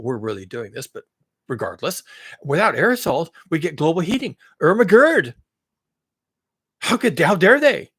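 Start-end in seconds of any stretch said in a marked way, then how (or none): 4.6-7.9 loud, high mock outrage; sarcastic outrage. Mocking blame of China, sarcastic outrage. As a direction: drag it out in pretend indignation. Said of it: "I'm being sarcastic here".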